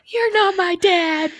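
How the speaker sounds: put-on high, slow childish lament; hammed-up toddler voice; mock baby talk. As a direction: whimper it like a toddler. Acting out a childlike scene.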